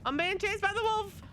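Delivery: high, loud panicked cry; being chased. Real, not acted. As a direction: yelp it in rising panic. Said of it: "repeats line louder".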